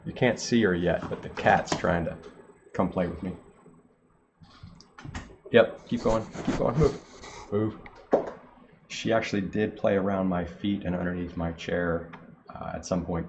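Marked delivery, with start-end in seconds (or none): 5.5-7.8 higher, louder, urging the cat along; shooing the cat. Real, not acted.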